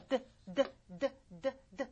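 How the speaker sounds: 드 as short voiced taps. Shown as a demonstration. Teacher showing the ringing D, 드 short and voiced. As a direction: tap out each 드 with the voice on. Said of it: "여기 D도 울립니다".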